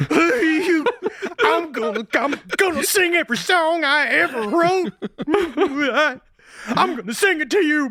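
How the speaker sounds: high, hammed-up weepy voice; mock tearful farewell. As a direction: choke out the words in fake sobs. Acting Danny's tearful farewell tape.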